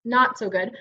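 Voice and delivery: usual speaking voice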